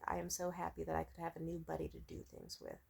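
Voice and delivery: softly voiced past happy thought; retold gratitude. Recalling joy at a new buddy, softly. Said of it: "I was just like".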